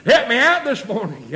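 normal talking voice

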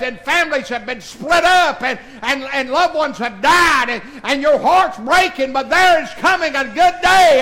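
full-force preaching. Acted. Pastor lifting the congregation toward heaven, full-force preaching.

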